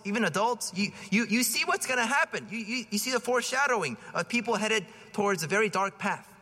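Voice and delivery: ordinary talk, nothing marked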